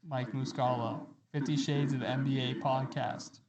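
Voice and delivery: helmet voice changer, Kylo Ren-ish. Real, not acted. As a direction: speak through a masked helmet voice changer. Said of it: "I sound vaguely like Kylo Ren".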